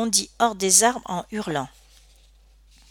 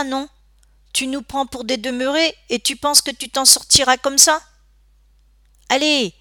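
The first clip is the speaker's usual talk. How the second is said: higher, louder bullying interrogation; aggressive taunting; 5 semitones higher, 4 dB louder than usual. A narrator's reading. Gang bully cornering a silent boy by the ravine, aggressive. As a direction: threaten and goad with raised, pushy voice.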